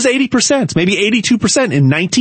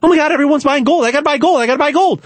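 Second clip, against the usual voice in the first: high, fast hammed-up panic; mock panicked buyer; 7 semitones higher, faster than usual. Mocking herd investors who panic-buy at the top, high hammed-up panic. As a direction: gush like a sheep chasing the crowd. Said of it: "The fear of missing out".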